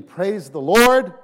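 really mean it and proclaim it slowly; heartfelt praise. Shown as a demonstration. Pastor shows a sincere praise to the congregation, slow and loud. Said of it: "if you really mean".

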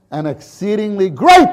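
"great" punched high and loud; stress on great. Shown as a demonstration. Preacher adding emphasis on "great", loud and high. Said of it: "I added the "great" for emphasis".

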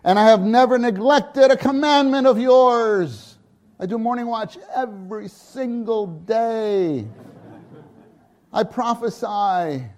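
high, loud bragging; older son boasting to father; smug boasting. Acted. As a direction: brag about your record. Mocking older son's boasting.

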